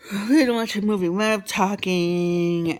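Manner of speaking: loud, quick correction; excited correction. Real, not acted. Excited correction, much louder.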